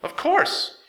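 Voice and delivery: high-pitched little mechanic voice; squeaky excited helper; high character voice. A narrator's reading. Ratchet's character voice.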